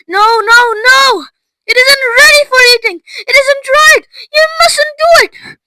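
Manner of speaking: high, loud panicked protest; frantic pleading. A narrator's reading. Mister Wonka wringing his hands, frantically protesting. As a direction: beg her urgently to stop.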